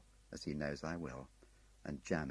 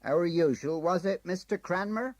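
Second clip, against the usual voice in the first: much higher friendly clerk voice; bright booking-office chat; cheery clerk; 13 semitones higher, 10 dB louder than usual. Acted. Ticket clerk Tom greeting a regular, cheery.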